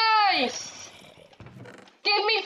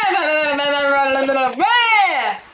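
frantic yelling; 6 semitones lower, 6 dB louder than usual. Real, not acted. Frantic search in stronghold.

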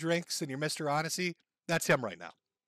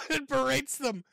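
repeated through a burst of laughter, higher; cracking up mid-phrase; laughing; 9 semitones higher, 3 dB louder than usual. Real, not acted. Host laughing at Mersh's clip, repeating through laughter.

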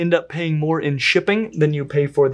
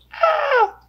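high-pitched mock-panic scream. Host as the vinegaroon turns, mock scream. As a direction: shriek in pretend terror.

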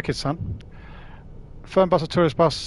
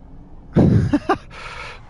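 short airy laugh at a chat message; breathy laugh. Real, not acted. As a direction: let a quick unvoiced laugh out.

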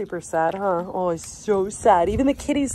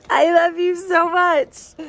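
slow, choked-up I love you, louder; tearful love; 7 semitones higher, 7 dB louder, slower than usual. Real, not acted. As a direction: let the voice crack with affection. Overcome while hugging the goat.

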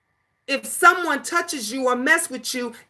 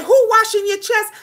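higher, louder teasing question; high quizzing mom; 9 semitones higher, 4 dB louder than usual. Retold or imitated. Mom quizzing her little girl in the bath, higher and louder. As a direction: pop the question to the kid like a game.

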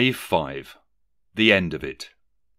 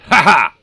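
loud, high "ha ha"; gleeful acted laugh; 10 semitones higher, 13 dB louder than usual. Scrooge pleased with his own note, laughing. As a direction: crow with triumphant laughter.

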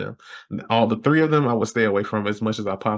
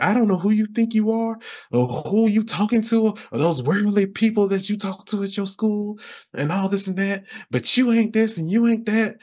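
much higher, preachy scolding torrent; ranting mom; 9 semitones higher than usual. Retold or imitated. Mom scolding her son about his worldly friends, in a high preachy voice. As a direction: lay it on like a disapproving churchgoing mother.

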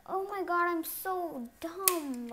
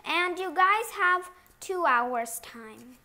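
teacher voice; 3 semitones higher, 9 dB louder than usual. Pretend. Teacher addresses class.

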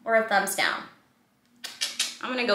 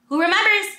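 eager call; 9 semitones higher, 7 dB louder, faster than usual. Acted. Teacher calling out to kids.

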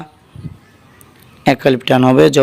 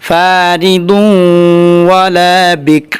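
recitation; 3 semitones higher, much slower than usual. Shown as a demonstration. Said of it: "যেমন".

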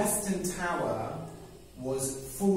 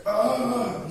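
furious growl of rage; 8 semitones higher, 7 dB louder than usual. Acted.

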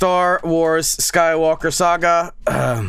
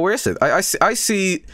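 usual speaking voice

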